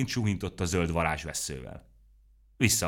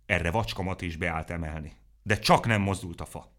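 ordinary delivery, nothing marked

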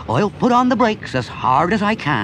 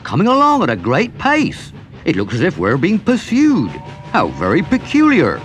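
louder alarmed call over the engine; 3 dB louder than usual. A narrator's reading. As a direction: shout back the news. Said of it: "he called out".

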